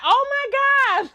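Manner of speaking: retold shock, high and drawn out; shocked exclamation. Acts out own shocked reaction.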